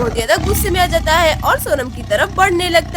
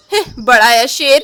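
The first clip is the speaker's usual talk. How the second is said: much lower scornful jeer; mocking taunt; 9 semitones lower, 3 dB louder than usual. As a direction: scoff at the beaten lion. Chanda mocks the fleeing lion. (शेर का मज़ाक उड़ाते हुए गहरी आवाज़)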